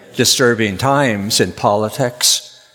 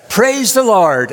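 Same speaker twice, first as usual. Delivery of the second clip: excited praise; 9 semitones higher than usual. Acted. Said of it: "he said".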